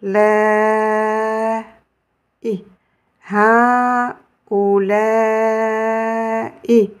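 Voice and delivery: voyelle ou tenue longtemps, syllabe par syllabe; en quatre temps. Shown as a demonstration. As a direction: tenez le ou sur quatre temps. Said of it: "la prononcer en quatre temps". (prononcez ou longuement, sur quatre temps)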